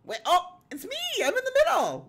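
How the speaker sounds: loud, high squeal of delight; ear-splitting excitement. Real, not acted. Streamer spotting herself in the middle, ear-splitting excitement. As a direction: shriek happily in surprise. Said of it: "I just made Will's ears hurt".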